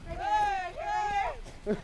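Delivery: loud high call; calling the ball. Real, not acted. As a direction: shout for the ball. Calling for the pass.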